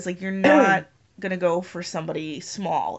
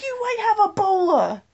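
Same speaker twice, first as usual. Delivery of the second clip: high-pitched exasperated retort; hammed-up, incredulous scolding; mock outrage; 13 semitones higher than usual. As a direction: exclaim it high in mock alarm. Acts out scolding the doctor.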